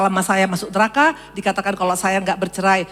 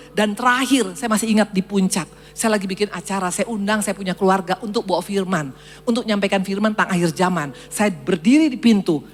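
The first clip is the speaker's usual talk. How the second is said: normal talking voice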